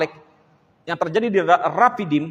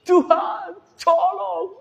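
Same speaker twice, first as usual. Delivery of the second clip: much higher, hammed-up cry; mock dramatic plea; 11 semitones higher, much slower than usual. Shown as a demonstration. Preacher joking about dramatic prayers, high and hammed. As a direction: overact it like a soap-opera heroine. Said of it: "bisa ngomongnya gini".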